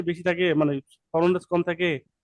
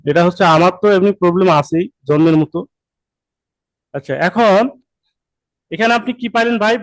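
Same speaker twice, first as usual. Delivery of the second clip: loud; 9 dB louder than usual. Real, not acted. Speaks up over bad connection.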